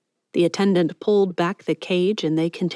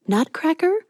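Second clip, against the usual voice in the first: startled question; 10 semitones higher than usual. Acted. One word jumped out.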